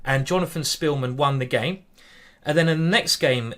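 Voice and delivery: usual speaking voice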